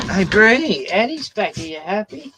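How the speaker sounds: high, sing-song voice to a pet; high cooing pet talk. Real, not acted. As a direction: coo at the dog. Baby talk to dog.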